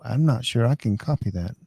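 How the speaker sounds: usual speaking voice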